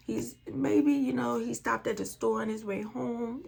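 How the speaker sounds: normal talking voice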